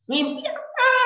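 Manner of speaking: put-on high, curious child's voice; a boy pestering his father with questions. Young Ismail asking his father about the dream in a high child voice.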